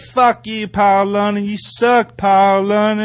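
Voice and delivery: put-on high-pitched jeering kid voice; mocking kid heckler. Teen fan jeering the wrestler at ringside, high mocking yell. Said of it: "he was like".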